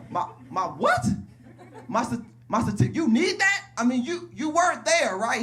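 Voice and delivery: high, loud, stammering scramble; flustered panic. Pretend. Dead man caught without his certificate, stammering in panic. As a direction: trip over your words caught out.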